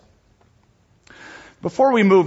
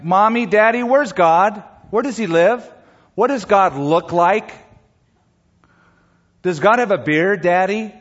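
put-on higher-pitched little kid questions; lightly acted kid voice, pitched up; curious child; 5 semitones higher, 3 dB louder than usual. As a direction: ask like a small child pestering parents. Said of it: "as kids grow up, they ask".

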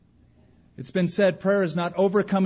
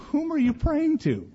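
mock incredulous; 12 semitones higher than usual. Mock questioner.

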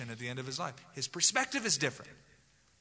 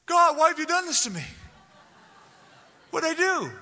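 much higher and louder, pleading with God; anguished outcry; 13 semitones higher, 8 dB louder than usual. Acted. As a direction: cry out as if wronged by heaven. Acted cry of complaint to God.

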